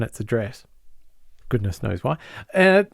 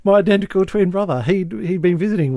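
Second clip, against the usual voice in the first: higher-pitched, eager retold alibi; mock innocent explanation; 7 semitones higher than usual. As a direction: act out an unconvincing cover story. Acting out twin brother cover story.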